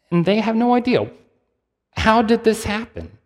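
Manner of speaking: high, incredulous voice; baffled disbelief. Retold or imitated. Neighbors asking how the blind man sees, high and baffled. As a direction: raise the pitch in wonder, can't believe it.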